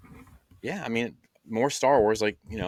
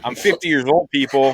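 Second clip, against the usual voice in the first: loud, higher comic protest; mock protest; 5 semitones higher, 7 dB louder than usual. Real, not acted. Host defending his pronunciation to co-hosts, loud mock protest. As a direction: protest loudly to the teasing room.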